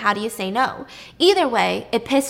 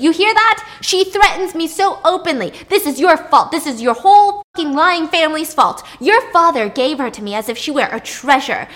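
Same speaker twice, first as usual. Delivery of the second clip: much higher, shouted furious tirade; exploding in anger; 5 semitones higher, 8 dB louder than usual. Retold or imitated. Minos raging at Circe about his wife, shouting. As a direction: blow up, spitting every word. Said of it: "Minos exploded".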